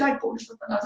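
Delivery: breathy, soft mocking sigh; mocking people who pity Satan.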